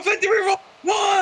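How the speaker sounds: yelling the pin count high and loud; excited shout. Real, not acted. Near-fall pin count call.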